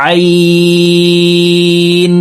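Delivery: আইন held long from the middle of the throat, slow and sung; drawn-out letter. Shown as a demonstration. Teacher shows viewers the Qur'anic letter, slow and chanted. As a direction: stretch আইন over three alif lengths. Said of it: "তিন আলিফ টেনে পড়তে হবে".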